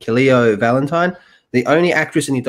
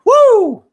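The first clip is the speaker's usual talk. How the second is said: very high, loud drawn-out whoo; high celebratory whoop; excited whoop; 10 dB louder than usual. Real, not acted. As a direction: let out a piercing cheer. Cheering at the news read out.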